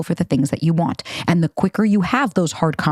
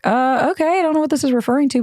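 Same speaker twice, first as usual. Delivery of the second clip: confused; 8 semitones higher, faster than usual. Retold or imitated. Quoting her confused reply.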